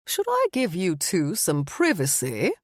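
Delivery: lightly voiced British vampire, teasing; a teasing hint of a British accent; joking British accent. A narrator's reading. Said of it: "Clarence joked in his impeccable British accent".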